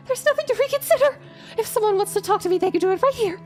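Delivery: high, fast, panicked; frantic frightened protest; scared horror game streamer. Acted. Panicked refusal.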